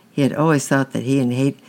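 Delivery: usual speaking voice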